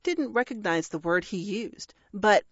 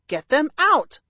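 high, loud demand; insistent; 6 semitones higher, 7 dB louder than usual. A narrator's reading. Parrot demanding the dragon act, loud insistence. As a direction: insist loudly. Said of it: "Bertie insisted".

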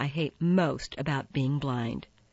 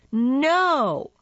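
high, loud wailed no; loud protest; 10 semitones higher, 7 dB louder than usual. A narrator's reading. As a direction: draw the no out high and loud as you interrupt. Marissa's interrupting wail in the story.

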